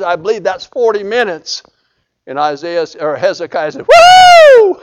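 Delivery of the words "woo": very high, loud shout of joy; joyful whoop. Retold or imitated. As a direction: whoop like a healed man. Hezekiah rejoices at sign.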